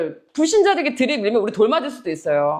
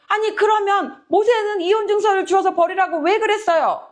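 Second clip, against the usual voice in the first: high-pitched, pressing objection; argumentative challenge; 10 semitones higher than usual. Retold or imitated. Listeners questioning Jesus about Moses' divorce law, arguing back. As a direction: argue back in a raised pitch. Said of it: "따지기 시작한 겁니다".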